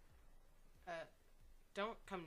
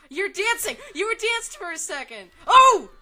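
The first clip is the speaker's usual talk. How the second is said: shrill excited shriek, much higher; shrieking jackpot winner; 10 semitones higher, 18 dB louder than usual. Real, not acted. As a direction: squeal with disbelieving delight. Shocked by surprise emote.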